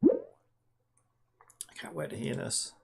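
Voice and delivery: soft, slow eager whisper. Real, not acted. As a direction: breathe it out, barely voiced. Eager whisper while waiting for output.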